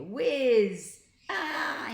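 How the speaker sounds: high whooshing cry; flying cry. A narrator's reading. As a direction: yell as you zoom away. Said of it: "he cried".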